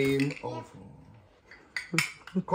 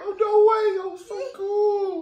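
excited. Real, not acted. High excited reply to child.

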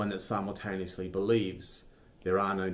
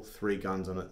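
ordinary talk, nothing marked

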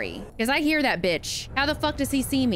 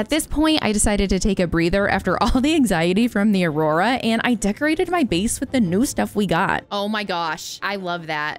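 louder recorded recap voice; louder recap voiceover; voiceover narration; 5 dB louder than usual. Acted.

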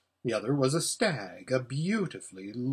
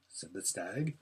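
ordinary delivery, nothing marked

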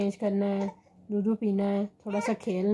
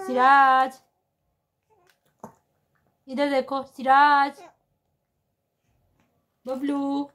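calling out; 4 semitones higher, 5 dB louder, slower than usual. Real, not acted. Mother calls toddler Siraj to look her way, raised voice. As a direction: call him to look over.

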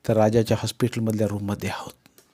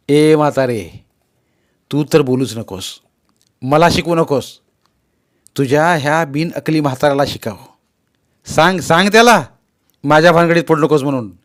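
louder, furious yelling at his mother; yelling loudly; 3 semitones higher, 7 dB louder than usual. Acted. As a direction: shout her down with contempt. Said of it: "ओरडायचा जोरात". (आईवर जोरात ओरडणारा मुलगा)

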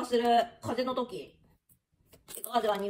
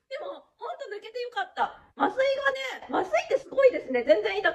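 laughing; 10 semitones higher than usual. Real, not acted. Relieved after tooth extraction.

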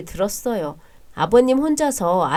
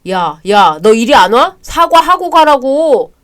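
furious yell; 4 semitones higher, 9 dB louder than usual. Acted. Brother yells after departing sibling.

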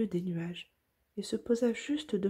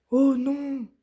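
much higher and louder, shocked; dismayed exclamation; 7 semitones higher, 8 dB louder than usual. Acted. Santa finding his sleigh gone, shocked exclamation. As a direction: cry out in dismay. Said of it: "s'exclama-t-il".

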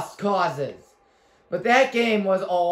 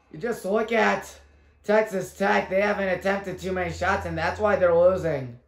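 ordinary talk, nothing marked

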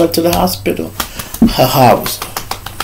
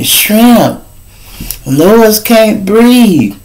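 urgent call; 6 semitones higher, much slower than usual. Retold or imitated. Caller reporting emergency.

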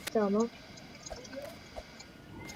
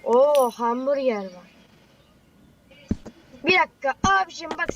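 ordinary talk

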